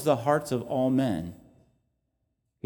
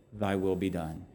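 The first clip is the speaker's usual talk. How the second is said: hushed prayer; 4 semitones lower, 8 dB quieter than usual. Acted.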